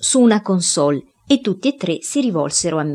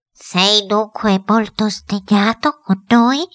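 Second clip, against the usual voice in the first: high, slow, anxious question; coaxing headmistress; 4 semitones higher, 3 dB louder, slower than usual. A narrator's reading. Headmistress asking the silent spirit about his anger, high and slow. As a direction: draw the question out, pleading upward.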